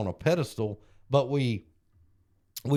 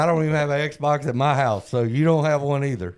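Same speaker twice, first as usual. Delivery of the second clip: loud teasing reply; 3 semitones higher, 6 dB louder than usual. Retold or imitated. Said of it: "I was like".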